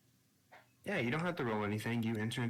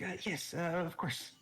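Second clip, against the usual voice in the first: higher, hesitant polite reply; hesitant character voice; 9 semitones higher than usual. Retold or imitated. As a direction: stammer it out politely. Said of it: "yours is like".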